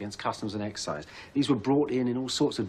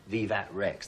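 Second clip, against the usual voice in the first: ordinary delivery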